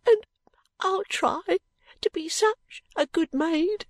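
put-on very high, halting child; overwhelmed small maid's voice; little girl, heaving heart. A narrator's reading. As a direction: promise earnestly through welling emotion. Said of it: "cried Charley with a heaving heart".

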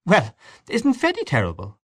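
high-pitched, animated exclamation; lively female character. A narrator's reading. Mary Jane chatting about Freddy, animated high voice. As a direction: lift the voice into lively, swooping pitch.

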